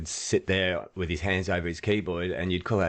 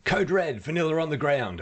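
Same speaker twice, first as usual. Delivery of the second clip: urgent call; 10 semitones higher than usual. Retold or imitated. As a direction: call it in like an emergency. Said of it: "they'd say".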